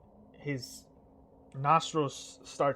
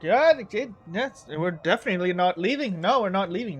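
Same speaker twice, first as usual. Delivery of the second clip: high, loud, brash refusal; cocky insisting; 4 semitones higher, 7 dB louder than usual. Acted. The twins refusing to leave the king's hall, cocky and loud. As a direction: plant your feet and crow it. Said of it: "He's like".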